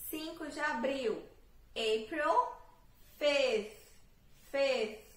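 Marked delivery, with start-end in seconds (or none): 1.7-5.0 "fifth" with a louder th at the end. Shown as a demonstration. Teacher models the final th for students. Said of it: "colocar o T H no final".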